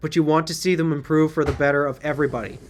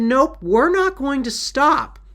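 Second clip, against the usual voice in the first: mock defiant refusal; 8 semitones higher, slower than usual. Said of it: "somebody completely decided".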